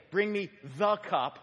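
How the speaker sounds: deliberate command, punching "the"; stress on THE. Shown as a demonstration. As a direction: hit "the" hard to single out one cup. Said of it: "I just said "the cup".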